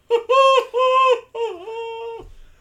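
shrieking laugh. Real, not acted. Laughing after Sloth impression.

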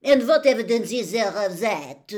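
very loud, high challenge; loud, exasperated question; exasperated headmistress. A narrator's reading. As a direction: demand proof as an affronted madame. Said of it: "said Madame Maxime, throwing up her huge hands".

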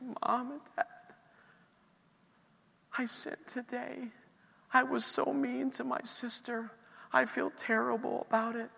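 put-on high, halting voice of a crying child; tearful daughter confessing. Young daughter confessing to her parents at night, high tearful imitation. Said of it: "she just starts crying".

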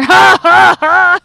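very loud, high mock "ha, ha, ha"; booming laugh imitation. Imitating a coworker heard across work, booming mock laugh. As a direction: boom out an obnoxious fake laugh. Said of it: "Your voice was amplified".

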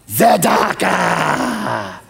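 tzedakah drawn out very slowly, roared from the throat; booming tzedakah. Shown as a demonstration. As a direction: roar tzedakah like a lion king. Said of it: "Kinda like Mufasa".

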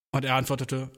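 usual speaking voice

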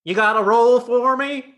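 high, hopeful actor's voice; an eager actor asking his brother for a part; Clint Howard. Acted. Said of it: "Clint, Clint, come on in".

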